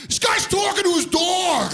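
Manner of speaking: put-on high, slow kid shout; mocking child yelling; kid's shout. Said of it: "heard this kid behind me go".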